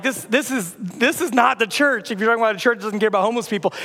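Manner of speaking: ordinary talk, nothing marked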